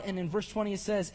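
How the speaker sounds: ordinary talk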